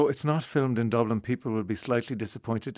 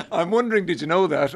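laughing; 8 semitones higher, 5 dB louder than usual. Real, not acted. Laughing while asking host question.

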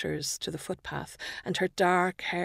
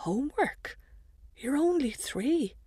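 incredulous; 8 semitones higher than usual. Acted. Mother answers toddler incredulously.

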